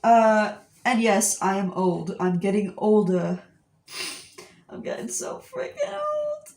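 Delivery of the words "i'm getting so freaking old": very high, soft squeaky lament; mock wail. Lamenting getting older, mock wail. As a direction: whine it up in a tiny high voice.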